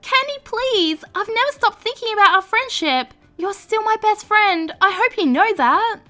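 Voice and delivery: put-on reach out to an old friend; tender plea. Stepmom begging her friend to forgive her, tender.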